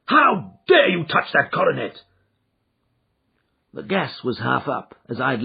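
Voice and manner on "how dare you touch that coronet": high, loud outraged yelling; furious accusation. Acted. Father catching his son with the coronet, furious. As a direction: hurl the accusation at him.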